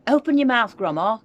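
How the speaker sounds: loud, high urgent call; cried out. A narrator's reading. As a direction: shout it to save her. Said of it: "he cried".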